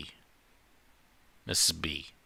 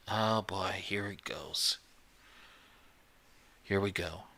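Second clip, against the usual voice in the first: softer, whispered groan of resignation; weary whisper; 5 dB quieter than usual. Acted. Liam whispering dread as the counselor starts lecturing. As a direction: mutter it like you've heard it all before.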